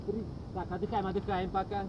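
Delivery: normal talking voice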